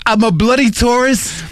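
high-pitched mock Brit; British accent. Mocking the driver for a laugh, British accent. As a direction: exaggerate the British tourist voice. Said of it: "an old guy with a British accent".

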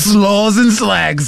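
high, slow British caricature; mock British accent. Riffing as the randy tourist, mock British accent. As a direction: drawl it like a silly British tourist. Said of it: "old guy with a British accent".

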